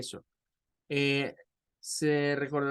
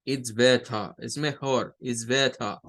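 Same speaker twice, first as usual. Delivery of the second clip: British "better" ending in schwa, no R; London "better", T clear, final R dropped; British accent; 4 semitones lower, slower than usual. Shown as a demonstration. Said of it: "al final el schwa". (acento británico, better terminando en schwa sin R)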